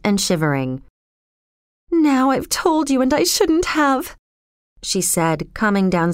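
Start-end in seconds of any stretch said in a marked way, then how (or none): 1.9-4.1 put-on high girl's voice, a touch of crying; regretful, lightly tearful confession. A narrator's reading. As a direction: blurt it out, mildly upset. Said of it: "She was crying heavily".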